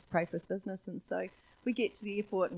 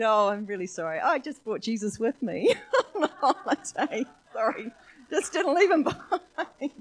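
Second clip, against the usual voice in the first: giggling through a mock apology; loud, laughing reply to her son; 4 semitones higher, 9 dB louder than usual. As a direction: laugh while saying sorry.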